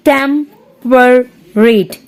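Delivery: Temperate very slowly as "tem... per... rate"; three separated beats in Temperate; split into syllables. Shown as a demonstration. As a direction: sound out Temperate one syllable at a time. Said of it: "three syllables इसमें".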